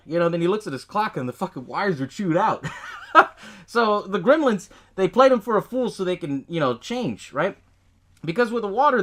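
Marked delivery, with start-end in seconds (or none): 2.7-3.6 short burst of breathy laughter. Real, not acted. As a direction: crack up at the joke. Cracking up at the chewed wires.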